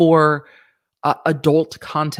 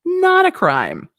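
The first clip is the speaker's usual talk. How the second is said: exaggerated; 14 semitones higher, slower than usual. Real, not acted. Escalated repeat.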